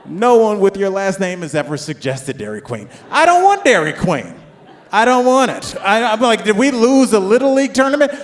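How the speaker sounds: high, loud mock outburst; outraged dad. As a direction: blow up in indignant protest. Dad's acted outburst at Dairy Queen.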